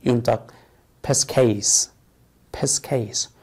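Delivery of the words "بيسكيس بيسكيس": بيسكيس said "PIS-kays", with a k sound; less common pronunciation. Shown as a demonstration. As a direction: say بيسكيس with hard k and "ay". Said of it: "وفي نطق أقل شيوعًا". (نطق بيسكيس النادر بصوت الكاف)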